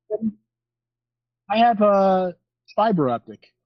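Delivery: usual speaking voice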